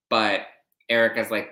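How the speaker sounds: normal talking voice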